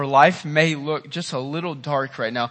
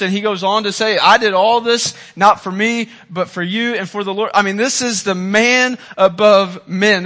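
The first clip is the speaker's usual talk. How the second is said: loud, fast, raised preaching; preacher driving the point home to the congregation; preaching at full force; 5 semitones higher, 7 dB louder than usual. Acted.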